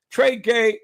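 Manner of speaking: normal talking voice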